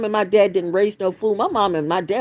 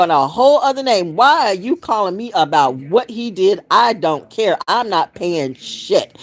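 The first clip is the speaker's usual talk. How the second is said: usual speaking voice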